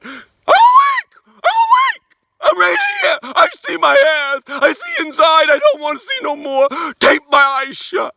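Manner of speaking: high, desperate shouting inside his head; inner panicked yelling. Retold or imitated. Patient screaming silently at the surgeons, high desperate shout. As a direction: yell that you're awake but nobody hears. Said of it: "my Wilbur's going".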